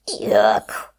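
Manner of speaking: low, loud grossed-out yuck; disgusted yuck. A narrator's reading. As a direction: spit out the sour taste. Fox tastes sour apple.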